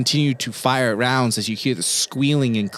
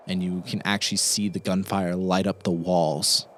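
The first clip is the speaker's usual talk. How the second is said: ordinary talk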